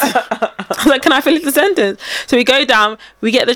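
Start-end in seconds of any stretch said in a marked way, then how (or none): 1.0-2.0 higher-pitched repeat of the protest; mock protest. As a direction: act out being cut off, voice lifted. Acted protest, repeated for effect.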